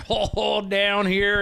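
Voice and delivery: high, hammed-up Southern US accent; mock Southern accent. American host showing how actors overdo it, exaggerated Southern accent. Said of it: "Oh, you gotta do Southern".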